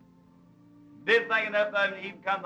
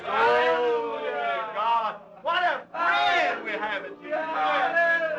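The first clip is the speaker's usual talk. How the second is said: fervent exclamation; 4 semitones higher, much slower than usual. Real, not acted. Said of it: "Let's just sing".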